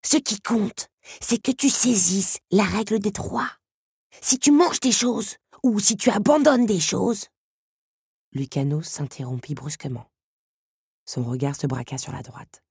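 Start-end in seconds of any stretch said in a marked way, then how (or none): 0.1-7.3 high-pitched urgent creature voice; emphatic warning, pitch held high; insistent. A narrator's reading. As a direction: press the rule on the listener. Beetle urgently instructs the girl.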